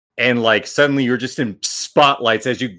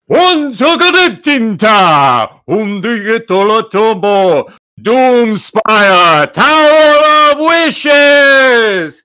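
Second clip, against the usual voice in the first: much louder and higher, stretched slowly; booming announcer; 9 semitones higher, 8 dB louder, much slower than usual. Acted. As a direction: boom it like an arena announcer. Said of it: "you hear a voice booming".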